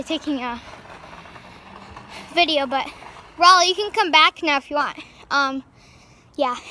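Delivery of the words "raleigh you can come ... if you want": loud, higher call to someone riding off; raised voice that carries. Real, not acted. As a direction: call your sister back from a distance. Calling sister back on scooter.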